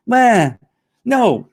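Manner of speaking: high, loud mock huffs of refusal; dismissive whiner. Mocking critics who reject everything, high dismissive grunts. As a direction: brush everything off like a sulky critic. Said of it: "The few people that are just like".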